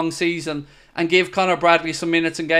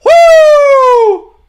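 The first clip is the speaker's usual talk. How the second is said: much higher, louder wrestler woo; Ric Flair woo; 12 dB louder than usual. Retold or imitated. Fan showing his dog doing Ric Flair, high loud woo. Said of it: "full Ric Flair".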